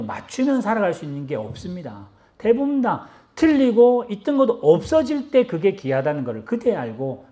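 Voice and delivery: ordinary talk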